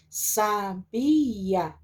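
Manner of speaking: SABIA drawn out as sa, bi, a; syllable by syllable. Shown as a demonstration. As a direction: slowly split "sabia" into three syllables. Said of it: "o A fica separado".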